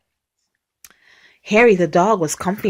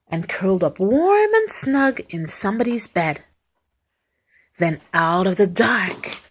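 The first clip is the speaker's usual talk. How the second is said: plain reading voice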